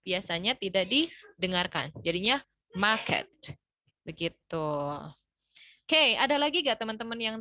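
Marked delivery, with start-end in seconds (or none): 2.8-3.3 non-rhotic "market"; British market, no R. Shown as a demonstration. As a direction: say "market" as British "mah-ket", R silent. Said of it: "in British English R-nya itu biasanya tidak didengarkan".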